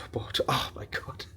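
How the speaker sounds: breathy whispered exclamation. Real, not acted. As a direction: whisper it in exasperation. Exasperated aside about running around.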